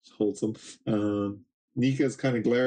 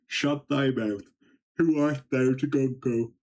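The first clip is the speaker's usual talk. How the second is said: archaic villain voice; 3 semitones higher than usual. Acted. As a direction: speak down at him like an ancient ruler. Imu speaking through Gunko.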